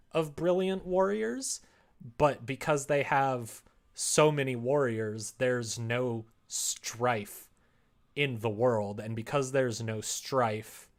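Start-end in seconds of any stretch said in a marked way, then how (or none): none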